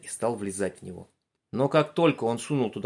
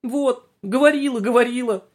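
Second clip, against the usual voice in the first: high whiny whimper, louder; 14 semitones higher, 7 dB louder than usual. Acted. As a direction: whine like a scared girl. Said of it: "захныкала Галка".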